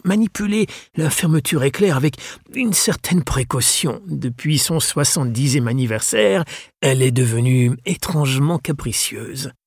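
voix plus aiguë de vieux gentleman, affable; vieux libraire anglais accueillant. Acted. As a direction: accueillir avec chaleur et cérémonie. (le libraire accueille Mathias, aimable et cérémonieux)

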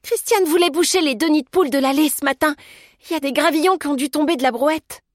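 high, agitated character voice; alarmed explanation. A narrator's reading. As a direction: explain it in a fluster, pitched high. Alarmed Liesel explains the gravel.

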